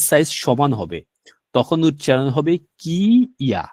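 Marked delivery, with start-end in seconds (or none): 2.8-3.6 long কি, pause, then ইয়া; separate pronunciation. Shown as a demonstration. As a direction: sound কি and ইয়া apart. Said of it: "এভাবে হবে উচ্চারণ".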